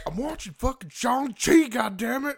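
imitating an angry dad; mock angry dad. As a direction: rant like an annoyed father. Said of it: "He's like".

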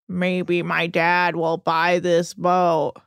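slow, low droning imitation; mocking a rich son's sluggish monotone; monotone drawl. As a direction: drag every word out on one note. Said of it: "in his weird monotone drawl".

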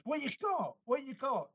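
very high, urgent barman voice; eager barman. Retold or imitated. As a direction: squeak the question out twice like a nosy barman. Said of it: "And the barman goes".

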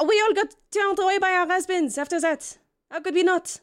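wronged wife, voice pitched high; indignant jilted wife. Acted. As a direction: throw the blame back at an ex-lover.

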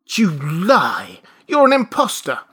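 loud, high indignant outburst; outraged accusation. Acted. Father accuses his supposed son, outraged.

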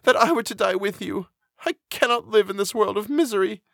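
high-pitched despairing lament; distressed friend, pitched high without shouting; crying out. A narrator's reading. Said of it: "cried she".

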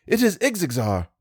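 It is Ixexar said high and eager, stress on is; excited cry of It is Ixexar; breathless excitement. A narrator's reading. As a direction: pitch It is Ixexar high with a falling end. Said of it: "I cried, breathless".

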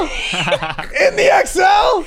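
very slow, high incredulous shout; mock yelling. Friend mocking passenger over the cheap car, mock yelling. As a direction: holler it again in drawn-out mock disbelief.

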